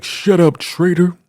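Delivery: high-pitched mock scolding; sharp command. Leader shutting up the traitor, high scolding command. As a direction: silence him in a high, cutting voice.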